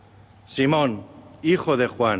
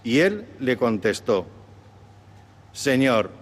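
solemn Gospel reading; 4 semitones lower than usual. Acted. Deacon proclaims the Gospel reading.

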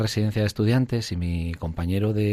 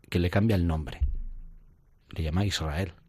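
ordinary delivery

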